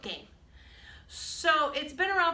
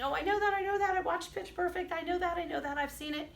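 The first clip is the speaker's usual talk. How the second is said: put-on higher, eager know-it-all kid voice; playful imitation of eager students; mock know-it-all students; 6 semitones higher than usual. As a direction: imitate kids bragging they already know. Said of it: "a lot of you I know are saying".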